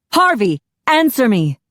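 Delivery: loud, high call, stress on Harvey; officer shouting for her partner; urgent shout. A narrator's reading. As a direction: demand an answer from the partner. Officer calling fallen partner.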